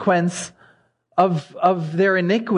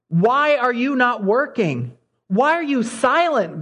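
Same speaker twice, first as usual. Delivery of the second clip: anguished cry; 7 semitones higher than usual. Retold or imitated. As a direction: cry it out, pleading upward. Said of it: "he cries out to God and says".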